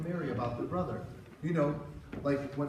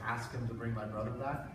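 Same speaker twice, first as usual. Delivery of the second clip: hinting; 5 semitones lower, 5 dB quieter than usual. Acted. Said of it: "Wink, wink".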